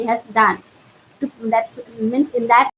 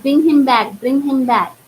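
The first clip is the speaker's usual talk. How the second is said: mild mock shout; 3 semitones higher, 3 dB louder than usual. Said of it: "she started shouting like".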